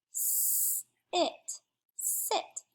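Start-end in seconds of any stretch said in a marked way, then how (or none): 0.1-1.6 "Sit" split as a long S, pause, then IT; segmented word. Shown as a demonstration. Teacher sounding out the next word, segmented. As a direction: hold the S of "Sit", then add IT separately. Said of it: "let's segment that".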